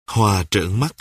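ordinary delivery, nothing marked

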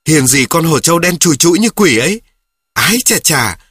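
a hotheaded kid mocking his friend's claim; heated. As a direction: pile on each "Hiền gì" with scorn. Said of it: "Đến lượt tôi nóng tai nó".

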